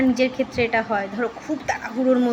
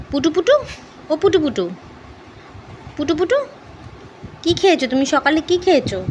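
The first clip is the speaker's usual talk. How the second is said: coaxing pet talk; 3 semitones higher, 5 dB louder than usual. Real, not acted. Owner coaxing her moping dog out of his sulk, high and loud. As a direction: tease a sulking dog into perking up, voice up high. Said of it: "একটু খুঁচিয়ে খুঁচিয়ে একটুখানি ওকে charm করতে হয়".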